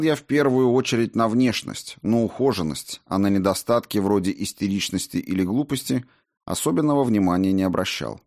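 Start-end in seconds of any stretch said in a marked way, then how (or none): none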